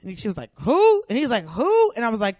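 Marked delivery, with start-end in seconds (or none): 0.6-1.0 high, loud "who?"; hammed-up puzzled outburst; imitated bafflement. Customer hears clerk's correction, imitated loud bafflement. 1.4-1.9 high, loud echoed "who?"; imitated bafflement. Clerk echoing the customer, imitated loud bafflement.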